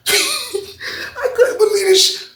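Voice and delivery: high, slow, laughing through it; laughing disbelief. Real, not acted. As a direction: laugh as you say it.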